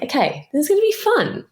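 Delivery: high, bright pep talk to each other; forced excitement. Retold or imitated. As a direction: talk yourselves into it, pitch rising. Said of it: "And we were like".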